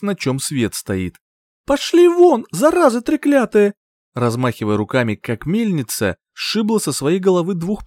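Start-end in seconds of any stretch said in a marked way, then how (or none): 1.7-3.7 high, loud shriek of abuse; angry cursing. A narrator's reading. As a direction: curse them off at a furious high yell. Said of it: "а я материлась".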